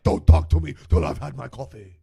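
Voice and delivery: low, gruff growl; grumpy coffee drinker. Retold or imitated. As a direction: grumble like a grouch before coffee. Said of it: "or they're like".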